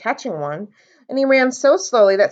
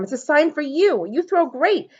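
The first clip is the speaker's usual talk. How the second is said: eager; 7 semitones higher than usual. A narrator's reading. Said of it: "said Sam".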